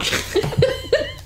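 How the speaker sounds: high squealing laughter. Real, not acted.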